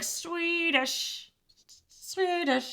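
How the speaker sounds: high, drawn-out Swedish; a light Tom Green impression. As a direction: stretch out Swedish high and slow. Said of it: "Tom Green, where he's like".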